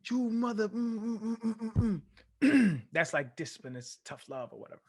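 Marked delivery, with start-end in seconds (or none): 0.1-2.8 censored mock yell, pitched high; mock coach yell. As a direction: imitate a coach cursing at a kid, bleeping himself. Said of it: "if you yell at a kid".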